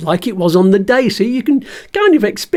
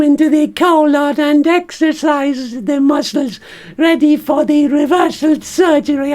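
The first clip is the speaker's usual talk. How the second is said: cheeky puppet surgeon with a much higher pitch; Bert the stoma bag as the surgeon; 8 semitones higher than usual. Pretend. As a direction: squeak it out like a little puppet doctor. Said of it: "can you be the surgeon for me".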